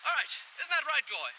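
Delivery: normal talking voice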